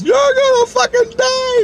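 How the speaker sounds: very high, drawn-out wail; hammed-up crying shriek, imitating her; tearful panic. Crying Dawn runs over to warn her coworker, tearful panic. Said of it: "Dawn started fucking crying".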